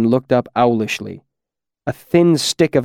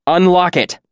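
higher, louder snapped order; sharp command; 7 semitones higher, 3 dB louder than usual. Acted. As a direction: bark the order at him. Elodin commanding the frightened keeper.

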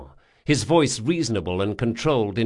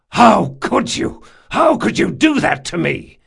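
anguished shout; 10 semitones higher, 8 dB louder than usual. Acted. Jean accuses Locke of betrayal, anguished shout. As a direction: hurl the question at him.

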